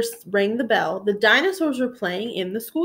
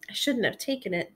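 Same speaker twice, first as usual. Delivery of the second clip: ashamed murmur; 8 dB quieter than usual. Acted. Ashamed admission.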